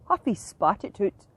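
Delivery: ordinary delivery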